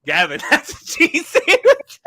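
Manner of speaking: high, loud, laughing correction; laughing quote. Retold or imitated. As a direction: say it while cracking up. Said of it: "And you went".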